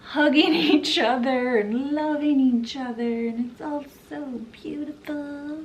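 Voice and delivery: high, gushing, softening toward the end; mock blissful. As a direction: imitate dreamy drugged adoration. Mocking the drugged bliss.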